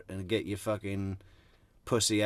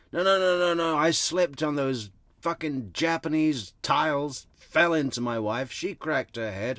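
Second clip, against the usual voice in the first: higher, louder mock defensive protest; mocking the abuser; 5 semitones higher, 4 dB louder than usual. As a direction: lampoon his whiny excuses, higher and louder. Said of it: "what a classic, uh, abuser, uh, reshaping of events".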